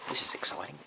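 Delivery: hushed whisper. Real, not acted. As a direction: secret excited whisper. Reading to child.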